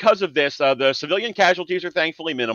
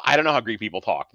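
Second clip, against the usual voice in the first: usual speaking voice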